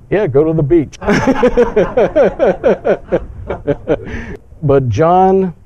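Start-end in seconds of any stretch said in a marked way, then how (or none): 1.0-4.4 short high laugh at his own joke; light laugh. Real, not acted. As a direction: chuckle at the beach line.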